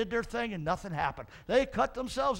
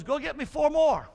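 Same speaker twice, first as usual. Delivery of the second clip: commanding; 7 semitones higher, 6 dB louder than usual. Acted. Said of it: "I won't do the sound effects".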